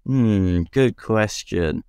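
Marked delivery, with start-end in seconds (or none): none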